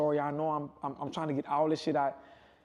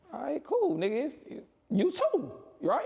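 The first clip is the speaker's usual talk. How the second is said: high, mock-breezy all right cool; sarcastic brush-off; 9 semitones higher, slower than usual. Brushing off his friend who dropped him, high sarcastic cheer. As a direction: toss it off with fake cheer to hide the hurt. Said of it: "so I'm like".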